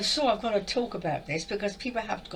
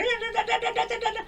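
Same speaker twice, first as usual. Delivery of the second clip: very high rapid da-da-da; imitating a fast talker; 14 semitones higher than usual. Mocking a channelled woman who talks too fast, high and rapid. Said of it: "one woman in particular".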